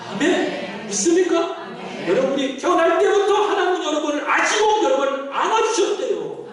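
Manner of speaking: impassioned pulpit proclamation; fervent preaching. Real, not acted. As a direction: proclaim it to the congregation. Preaches at full force to congregation.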